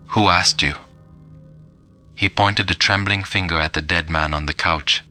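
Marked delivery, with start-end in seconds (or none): none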